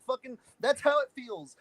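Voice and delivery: ordinary talk, nothing marked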